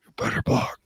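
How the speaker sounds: breathy whisper right in the ear. Retold or imitated. Friend mimics Brady whispering into a lineman's ear. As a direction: whisper it close into someone's ear. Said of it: "He gets right in their ear hole".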